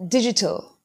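British digital with crisp t; British pronunciation. Shown as a demonstration. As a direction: say "digital" the British way, each sound clear. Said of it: "you could pronounce this word as".

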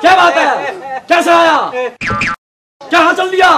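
high, loud, abrupt questions; shouted challenge. Acted. Questioning a man who is leaving, shouting. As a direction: call out sharply to stop someone.

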